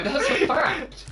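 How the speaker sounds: high, slow laughing insistence. Real, not acted.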